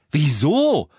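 lauter, empörter Ausruf. Acted. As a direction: ein scharfes Wieso rufen. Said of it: "rief Ron". (Ron ruft seiner Mutter empört zu)

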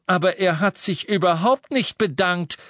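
object, outraged on Harry's behalf; indignant. A narrator's reading. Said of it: "sagte Hestia entrüstet".

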